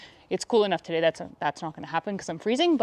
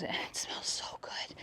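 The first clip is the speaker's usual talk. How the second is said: whispering; 15 dB quieter, slower than usual. Real, not acted. Savoring the soap smell.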